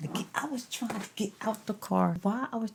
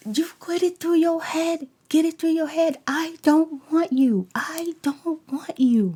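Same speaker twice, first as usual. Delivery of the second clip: loud, higher, slowing mock yell; mimicking the man yelling he doesn't want her; 8 semitones higher, 8 dB louder than usual. Quoting his yelled rejection.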